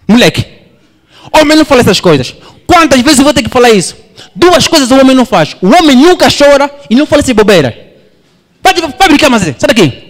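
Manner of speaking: high, loud, fast scolding; tired dad barking at his son; stressed angry father. Retold or imitated. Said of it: "aquela voz de estressado".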